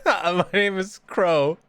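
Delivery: a lightly put-on name reveal, dragged out; put-on intro voice. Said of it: "I was just doing a bit".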